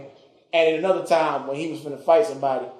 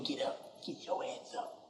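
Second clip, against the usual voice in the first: whispered, unvoiced order; hushed command; 18 dB quieter than usual. Coach father ordering his hurt son up, whispered.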